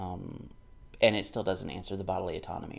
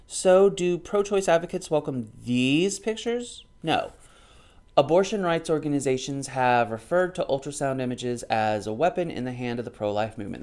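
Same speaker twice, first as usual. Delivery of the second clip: book read-aloud; 5 semitones higher, 8 dB louder than usual. Acted. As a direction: read it out like a quoted passage.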